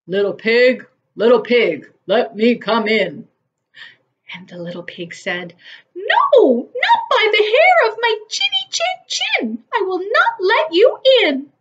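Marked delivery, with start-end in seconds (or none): 0.1-3.3 louder wolf calling at the door; wolf coaxing the pig, louder. A narrator's reading. Wolf calls at pig's door. 5.9-11.5 very high, loud piglet refusal; little pig refusing the wolf, high and loud; high squeaky pig. A narrator's reading.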